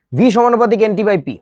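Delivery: calling the formula out loud to the class; loud dictation. Real, not acted. Teacher calling out to the whole class, loud.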